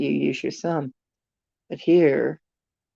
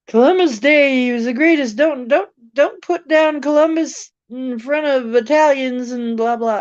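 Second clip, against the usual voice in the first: higher, louder mocking imitation; mocking quote; 9 semitones higher, 6 dB louder than usual. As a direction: parody a defensive Columbus fan. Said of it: "some people are like".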